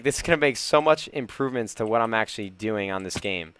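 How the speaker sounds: ordinary delivery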